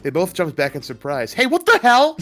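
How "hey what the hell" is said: high, loud startled shout; startled yell. Acted. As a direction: jump back and yell it. Kids startled by skateboarder.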